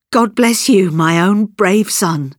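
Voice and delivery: heartfelt cry, high start, slowing down; emotional cry. A narrator's reading. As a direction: cry out a blessing, then draw it out. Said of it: "and she cried".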